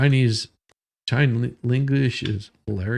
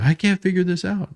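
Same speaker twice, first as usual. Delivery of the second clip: lightly mocked wife, pitched higher; imitating wife; 7 semitones higher than usual. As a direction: mimic your baffled wife in a higher voice. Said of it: "She'll sit there and go".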